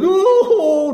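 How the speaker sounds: put-on high, loud child's wail; loud mock crying. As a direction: bawl like a terrified child. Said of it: "keras sekali nangisnya". (tangisan anak keras dibuat-buat)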